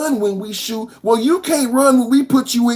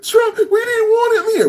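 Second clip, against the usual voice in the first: mock whining; 10 semitones higher than usual. Host imitates Chicagoans whining about Trump, high mock whine. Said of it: "don't get on here whining".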